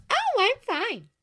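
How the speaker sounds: high, bright and chirpy; bright Glinda. A narrator's reading. Glinda answering Oscar brightly, high and chirpy. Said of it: "she replied brightly".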